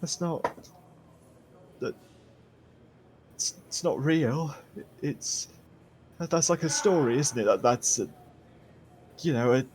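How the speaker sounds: high, soft, halting stammer; frightened prisoner unwilling to believe it; fearful denial. Acted. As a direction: trail off nervously between attempts. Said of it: "a new level of fear".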